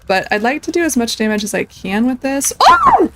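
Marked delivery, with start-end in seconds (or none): 2.6-3.1 very high, loud yelp of shock; startled shout. Real, not acted. Player caught off guard by the bug's attack, startled shout. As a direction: yelp as if ambushed. Sudden game surprise.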